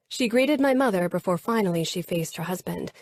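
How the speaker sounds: ordinary delivery, nothing marked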